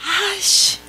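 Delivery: high, drawn exasperated mutter; exasperated grumble. Retold or imitated. Reacting to the fees arriving soon, exasperated. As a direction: let out an acted groan of annoyance.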